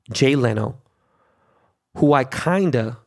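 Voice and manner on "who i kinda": "kinda" drawn out slowly and leaned on; heavy, deliberate stress on "kinda"; strong emphasis on kinda. Real, not acted. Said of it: "very strong emphasis on the word kinda".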